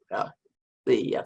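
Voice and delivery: ordinary talk, nothing marked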